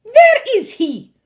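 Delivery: very high, loud snappish witch; witch snapping. A narrator's reading. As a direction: snap it, searching. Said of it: "snapped the Grand High Witch".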